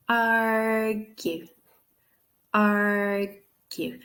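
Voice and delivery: AR-gue, first syllable held very long; stretched stressed syllable. Shown as a demonstration. Teacher correcting a student, ARGUE slowly with a long stressed AR. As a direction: draw out the AR of argue, then a short gue. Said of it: "indicate that that's where the stress is".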